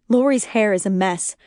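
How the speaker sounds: ordinary delivery, nothing marked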